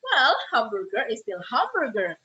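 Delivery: slow, savouring, with swooping stress on hamburger; drawn-out "Well", relishing the favourite food; appreciative fan intonation. Shown as a demonstration. A burger lover telling a friend it's always delicious, appreciative. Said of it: "kalau saya, eh, intonasinya seperti".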